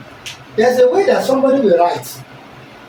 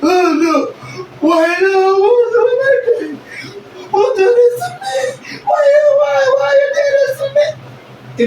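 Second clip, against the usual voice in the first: mock crying; slower than usual. Shown as a demonstration. Teacher acting out a crying person for the class. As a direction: wail it like someone in despair. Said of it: "somebody is crying like".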